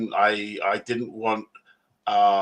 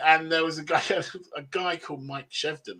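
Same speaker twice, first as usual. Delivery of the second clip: ordinary talk, nothing marked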